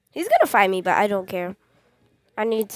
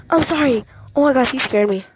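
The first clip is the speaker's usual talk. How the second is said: ordinary talk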